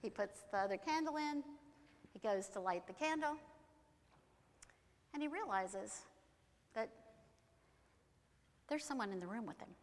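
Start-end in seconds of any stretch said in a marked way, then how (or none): none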